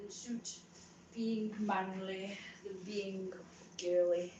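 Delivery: ordinary delivery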